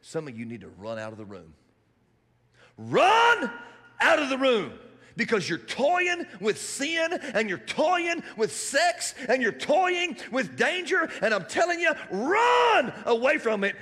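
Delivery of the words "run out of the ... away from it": much higher, louder, slow hammered warning; preaching at full force. Acted. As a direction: thunder the warning from the pulpit. Preacher exhorting the congregation.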